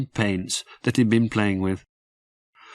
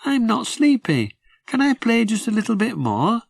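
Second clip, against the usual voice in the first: put-on high, puzzled little-boy voice; a small child wondering aloud; small boy Jim; 11 semitones higher than usual. Little Jim asks his sister why it's dark, high boy's voice. Jim's voice.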